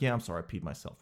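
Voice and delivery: low, soft, adult-like admission; owning-up kid. Retold or imitated. Kid confessing to an adult, low quiet admission. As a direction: drop pitch and volume, sheepishly grown-up. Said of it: "they're gonna be like".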